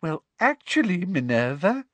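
high, sugary contradiction; condescending honeyed tone; simpering. Acted. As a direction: simper with false sweetness. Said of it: "simpered Professor Umbridge".

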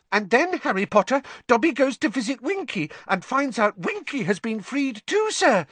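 high, excited squeak; delighted house elf. A narrator's reading. Dobby telling Harry Winky was freed too, delighted. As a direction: bubble over with the news. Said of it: "said Dobby delightedly".

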